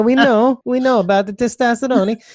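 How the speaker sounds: high-pitched, hammed-up Italian accent; playful fake Italian voice; mock Italian accent. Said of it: "like, an Italian accent".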